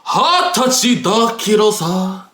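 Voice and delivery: slow, drawn-out Japanese in a put-on voice; mock a classmate labouring over his Japanese; show-off classmate. Imitating Tyrone answering in Japanese class, slow put-on show-off.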